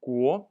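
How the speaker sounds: "Guo" loud with a rising second tone. Shown as a demonstration. Teacher modeling Chinese for learners, loud rising tone. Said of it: "second tone".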